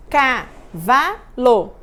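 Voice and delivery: "Cavalo" very slow, CA-VA-LO; "Cavalo" said in three slow beats; syllable count. Shown as a demonstration. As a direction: beat out each syllable of "Cavalo". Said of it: "Vamos contar quantas sílabas".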